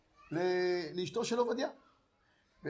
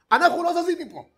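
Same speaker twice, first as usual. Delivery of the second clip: defiant shout; 9 semitones higher, 10 dB louder than usual. Acted. Sons refusing to leave.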